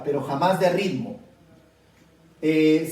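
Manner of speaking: usual speaking voice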